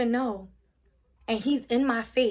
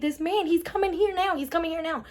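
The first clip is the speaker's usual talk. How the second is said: retold urgent alarm; 5 semitones higher than usual. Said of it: "I'm like".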